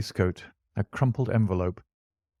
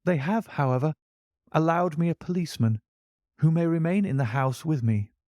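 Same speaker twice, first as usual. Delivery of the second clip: put-on lightly raised, earnest young man's voice; a touch higher, worried young gentleman; young client John Openshaw; 7 semitones higher than usual. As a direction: tell your troubles as the anxious young visitor. Young visitor's held voice.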